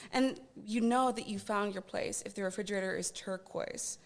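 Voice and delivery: ordinary talk, nothing marked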